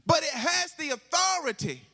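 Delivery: high, ringing pulpit proclamation; impassioned sermon delivery, stretching key words; full-force preaching. Real, not acted. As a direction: raise the pitch and proclaim it to the congregation.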